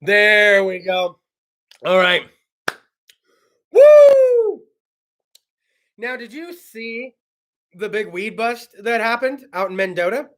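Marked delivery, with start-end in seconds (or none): 3.7-4.6 high loud whoop; excited whoop; woo whoop. Real, not acted. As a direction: let out a celebratory woo. Celebrating after fix.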